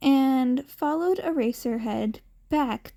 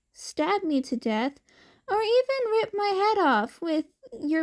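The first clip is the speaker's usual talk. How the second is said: put-on higher, carefree little boy voice; cheerful hungry kid; 6 semitones higher than usual. Cat boy tells the man he doesn't mind dying, pleading sweetly for food. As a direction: ask for food first, unbothered and sweet.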